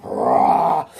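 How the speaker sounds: rolled, forceful roar; villain's roar. Acted. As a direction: roar as you burst free. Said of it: "And Electro gets up".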